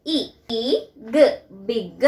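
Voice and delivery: "ഈ ഗ" as separate sounds, then "ബിഗ്"; phonics sounding out. Shown as a demonstration. Teacher answers what sound I makes, sounding it out. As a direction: isolate the short vowel "ഇ", then blend into "ബിഗ്". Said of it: "എന്താണ് sound?".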